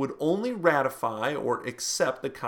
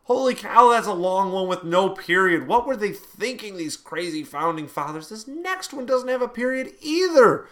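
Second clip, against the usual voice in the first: excited; 6 semitones higher, 7 dB louder than usual. Real, not acted. Amazed at a long amendment.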